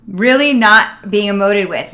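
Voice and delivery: drawn-out emphatic restatement; stressed repeat. Real, not acted.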